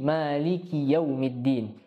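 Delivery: "Maliki yaumiddin" slow with "ki" kept short; slow, short-ki recitation. Shown as a demonstration. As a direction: run "ki" straight into "yau". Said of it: "tidak memanjangkan ki".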